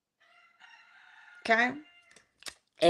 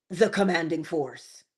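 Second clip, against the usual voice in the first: normal talking voice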